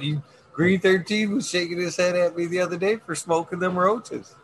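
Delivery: ordinary talk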